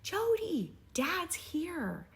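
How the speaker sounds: calling out to Jody on the stage to share the news; yelled. Retold or imitated. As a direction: shout her sister's name across the stadium. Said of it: "I yelled".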